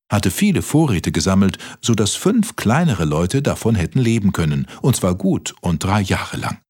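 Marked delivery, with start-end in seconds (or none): none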